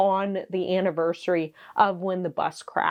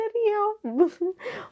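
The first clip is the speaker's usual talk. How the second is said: high giggle; 13 semitones higher than usual. Real, not acted. Laughing at own rambling.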